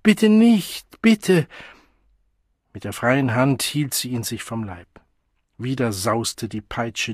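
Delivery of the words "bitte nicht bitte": high, loud begging in tears; tearful pleading. A narrator's reading. Crying child begging the woman with the whip, pleading. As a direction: beg desperately, raising the voice. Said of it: "Weinend zog er die Frau".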